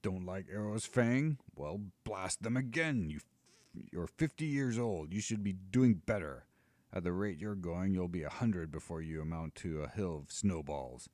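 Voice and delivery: a giant hectoring his pet; scolding giant. Acted. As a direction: push the dragon on like a gruff coach. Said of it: "cautions the giant".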